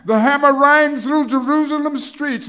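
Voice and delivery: high, very loud shout on rang; ringing shout. Acted. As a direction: ring it out across the city. First take, at the peak.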